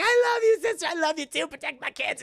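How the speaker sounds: high-pitched plea, starting loud; hammed-up last words of a victim; mock desperate farewell. Linda's last words while dragged away.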